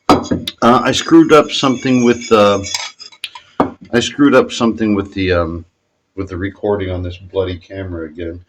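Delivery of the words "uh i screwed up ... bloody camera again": ordinary talk, nothing marked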